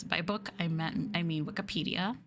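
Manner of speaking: ordinary talk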